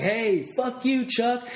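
high, slow, hammed-up drunk jeer; mock-yell it like a drunk buddy; imitating a hammered friend yelling into his phone; drunk friend's taunt. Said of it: "he's like".

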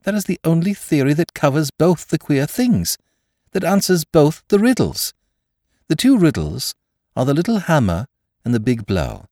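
high, eager rush of discovery; feverish excitement. A narrator's reading. Curate explaining both riddles to the doctor, feverishly. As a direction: press the theory home, voice lifted high. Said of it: "he cried feverishly".

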